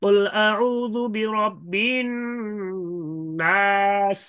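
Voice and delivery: slow chanted tilawat, last vowel faintly voiced; Quranic recitation with rawm. Shown as a demonstration. Teacher demonstrating stopping on নাস with a faint vowel. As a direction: recite কুল আউযু বিরাব্বিন slowly, drawn out and high. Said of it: "রুম করতে পারবে".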